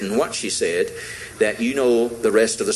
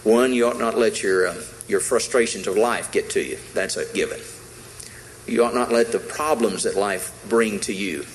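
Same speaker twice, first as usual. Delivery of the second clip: ordinary delivery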